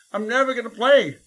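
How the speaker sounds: put-on a crushed kid swearing off music; hammed-up despair; despondent outburst.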